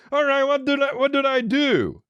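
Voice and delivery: very high, helpless falsetto; dopey dad voice slowing and stretching out; dumb commercial dad. Acted. Dad asks his family for help, mock idiot falsetto.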